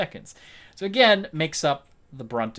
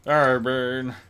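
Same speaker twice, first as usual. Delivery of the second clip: put-on character voice. Voices his pet in put-on voice.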